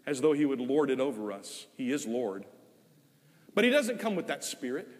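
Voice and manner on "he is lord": usual speaking voice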